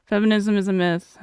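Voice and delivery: ordinary talk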